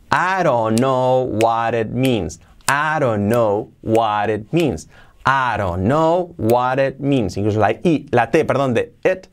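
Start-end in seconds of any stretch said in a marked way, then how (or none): 0.1-7.4 "I don't know what it means" drawn out as "a-ro-nou wa-ret miins"; slow linked model. Shown as a demonstration. Teacher modelling linked words for learners, slow and drawn out. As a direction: stretch the joined phrase slowly. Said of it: "la hacemos suavecita".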